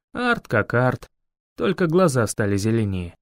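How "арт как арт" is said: plain reading voice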